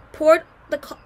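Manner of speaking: ordinary delivery, nothing marked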